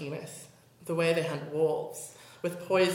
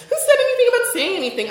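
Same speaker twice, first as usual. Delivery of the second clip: sharp retort; 9 dB louder, faster than usual. Acted.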